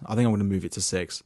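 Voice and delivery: usual speaking voice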